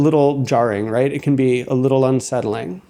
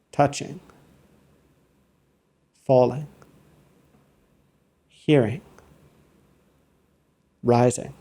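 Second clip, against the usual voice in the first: note each sensation aloud, unhurried and evenly spaced; measured, detached labelling with pauses after every word; meditative noting demo. Shown as a demonstration. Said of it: "real time out loud a demo".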